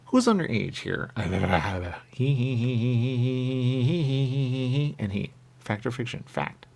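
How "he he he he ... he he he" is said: long string of he's, pitched up; chanted he-he-he. Acted. Going on after asking who's underage, chanted he-he-he. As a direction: repeat "he" on and on in a higher voice.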